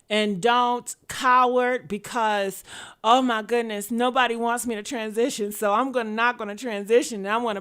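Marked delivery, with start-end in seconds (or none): none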